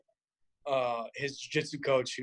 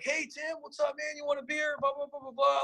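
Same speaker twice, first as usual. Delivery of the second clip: high-pitched mock bar greeting; hammed-up high chummy hello; excited buddy greeting; 10 semitones higher, 4 dB quieter than usual. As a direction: imitate eager pals hailing their hero. Acting out buddies greeting the champ.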